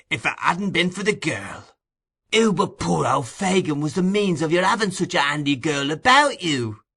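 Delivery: high eager wheedling; eager remonstrance. A narrator's reading. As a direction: seize the word eagerly.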